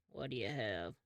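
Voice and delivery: low-voiced waiter taking an order. Waiter asking a diner for the order, lightly voiced low.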